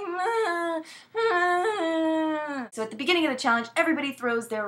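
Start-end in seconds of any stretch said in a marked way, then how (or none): 0.0-2.7 high whiny fake sob; mock crying. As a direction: whimper it like a crybaby. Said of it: "always crying about something".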